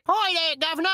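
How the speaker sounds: very high, loud mock Cockney; comedy Cockney accent. Chimney sweep greeting a gentleman, loud high mock Cockney. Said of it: "that comedy accent".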